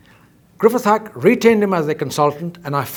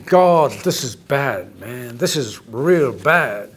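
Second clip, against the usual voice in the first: slow, lower, dragged-out dismay; dismayed thought; 4 semitones lower, much slower than usual. Retold or imitated. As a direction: let it sink in slowly, grimly. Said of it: "Now, if you're thinking".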